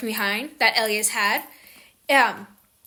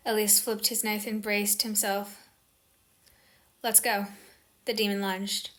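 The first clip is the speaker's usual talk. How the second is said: soft, hushed storybook reading; story narration; 2 semitones lower, 5 dB quieter than usual. A narrator's reading. Reads novel passage aloud.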